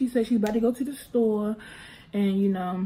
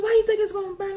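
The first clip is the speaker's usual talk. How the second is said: mom asking, high; 11 semitones higher, faster than usual. Retold or imitated. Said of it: "She was like".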